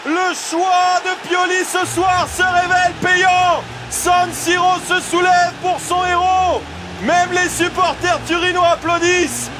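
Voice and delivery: high, stretched goal shout; excited sports commentary. Real, not acted. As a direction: belt out the solo goal. Goal call for Brahim Diaz. (commentaire de but exalté)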